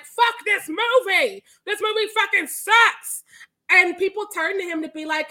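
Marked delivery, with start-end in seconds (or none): 0.1-3.2 loud, slow, higher jeering heckle; mock the heckler's angry shout; heckler yelling. Retelling the balcony heckler yelling at the talkback, slow and loud. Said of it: "Dude in the balcony house left is like".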